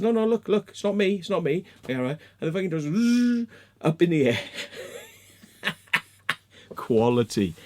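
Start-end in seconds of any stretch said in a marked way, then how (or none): 0.0-1.6 higher, insistent denial; mock-panicked insistence; retold urgent protest. Retold protest to police officer. 2.8-3.5 loud, high rising buzz; imitated drone buzz. 4.4-5.0 soft, high wheezing laugh; real laughter. Real, not acted. Laughing at the retold scene.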